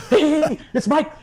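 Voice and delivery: high, loud imitation of an eager phone pickup; excited greeting. Label boss grabbing the phone mid-message, mock excited shout. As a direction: burst in laughing, loud and high.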